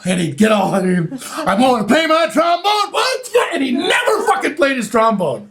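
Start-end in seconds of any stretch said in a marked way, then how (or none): 1.4-2.9 higher shouting performer; shouted mock performer. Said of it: "He'd go". 2.9-3.5 much higher mock crowd shout. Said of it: "the crowd would go".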